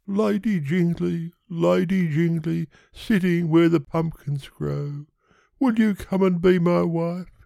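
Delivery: high-pitched pleading suitor; Yonghy Bonghy Bò. Acted. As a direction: propose in a high character voice.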